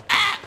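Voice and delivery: sudden high cry; high panicked yelp. Real, not acted. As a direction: yelp in alarm.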